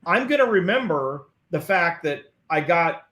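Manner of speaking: usual speaking voice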